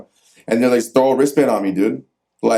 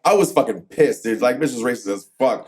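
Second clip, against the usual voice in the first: usual speaking voice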